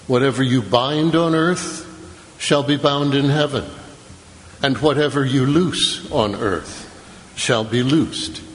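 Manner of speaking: usual speaking voice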